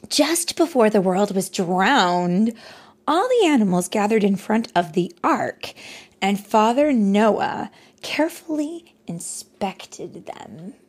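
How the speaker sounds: put-on bright, lively read-aloud voice for a child; story narration. A narrator's reading. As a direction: tell it like a bedtime story.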